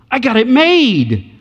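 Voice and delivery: smug boast. Acted. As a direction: say it like you've already won. Rich man boasting to Jesus.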